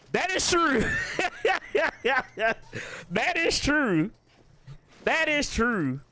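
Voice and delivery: high-pitched, laughing concession; tickled agreement. Real, not acted. Tickled agreement, laughing between repeats.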